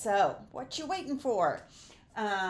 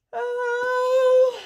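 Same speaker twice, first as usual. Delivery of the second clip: a sudden, much higher and louder exasperated oh; 13 dB louder than usual. Real, not acted. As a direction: let out a high, loud oh of frustration. Struggles with ink colour names.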